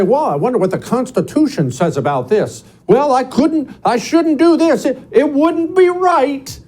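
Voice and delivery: high-pitched mimicry of a scrupulous worrier; climbing into a put-on high fretting voice; mock naive hand-wringer. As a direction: voice the timid objections high and mocking. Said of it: "the way you wake up and say".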